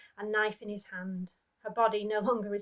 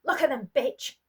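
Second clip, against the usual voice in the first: angry shout; 4 semitones higher, 8 dB louder than usual. Acted. Abusive husband yelling at wife.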